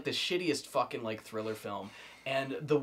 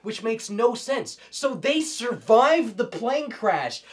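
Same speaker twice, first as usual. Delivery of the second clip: high, loud incredulous rant; exasperated rant; 11 semitones higher, 10 dB louder, slower than usual. Real, not acted. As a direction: rant in disbelief, voice rising. Ranting about plot.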